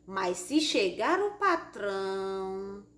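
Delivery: PATRÃO stretched out and left hanging; slow down, hang on the last word PATRÃO; suspenseful trailing off. Shown as a demonstration. Teacher showing ellipsis in a story, suspenseful. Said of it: "deixar algum assunto em suspense".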